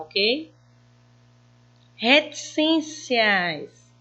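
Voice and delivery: ordinary delivery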